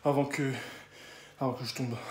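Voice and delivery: soft, low worn-out voice; exhausted, fading. Real, not acted. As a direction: fade out from hunger. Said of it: "Ça fait une dernière fois que je mange".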